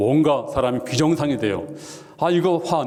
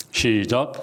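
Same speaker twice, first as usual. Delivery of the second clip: ordinary delivery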